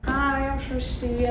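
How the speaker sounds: ordinary talk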